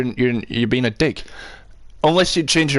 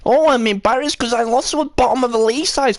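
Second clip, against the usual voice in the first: mock complaint; 8 semitones higher than usual. Mocks a complaining fan mid-rant.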